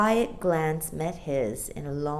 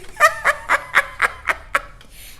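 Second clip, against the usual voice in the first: long, very high bird cackle; peal of laughter; 6 dB louder than usual. A narrator's reading. Starling laughing before flying off, high shrieking cackle. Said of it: "another loud peal of laughter".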